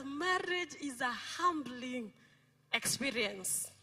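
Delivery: slow, measured, every word weighed; slow quoted wisdom. Retold or imitated. Preacher quoting a friend's advice, slow and deliberate. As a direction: quote the friend's words slowly and gravely. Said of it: "she told us".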